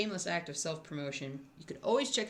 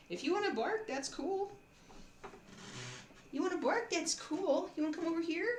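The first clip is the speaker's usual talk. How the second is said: higher sing-song voice for the dog; talking to the dog; 10 semitones higher than usual. Real, not acted. Coaxing the barking dog.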